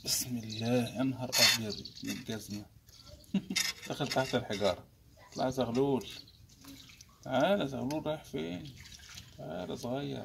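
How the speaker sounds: low murmured coaxing to the fish; hushed coaxing. Real, not acted. Angler murmuring to a hooked fish, hushed and coaxing. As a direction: softly sweet-talk the fish onto the hook.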